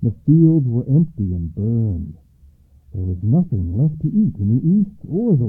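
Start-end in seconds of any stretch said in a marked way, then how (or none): none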